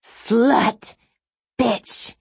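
high, angry insults flung out; angry shriek. A narrator's reading. Woman attacking a showgirl in public, angry shriek. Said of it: "the woman's shriek".

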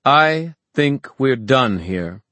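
slow, high, ringing declaration; loud, icy authority; cold, ringing. A narrator's reading. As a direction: announce it coldly to a whole crowd. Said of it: "spoke coldly in a ringing voice".

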